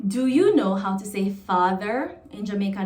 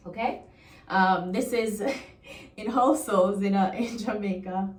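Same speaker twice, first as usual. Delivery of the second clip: ordinary delivery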